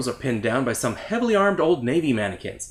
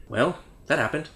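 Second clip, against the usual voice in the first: ordinary talk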